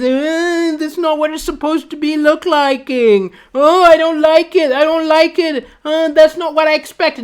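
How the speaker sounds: put-on high whiny child voice; angry kid. Kid upset about a Lego truck, whining. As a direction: complain like a spoiled little boy. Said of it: "Kid got very angry with me".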